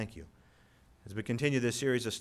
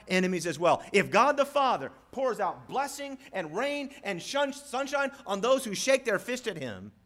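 loud, high, impassioned sermon climax; fervent preaching; 10 semitones higher, 9 dB louder than usual. Acted. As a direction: preach it at full force, voice soaring. Preacher rising to full force.

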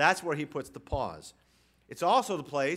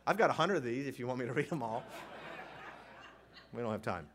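normal talking voice

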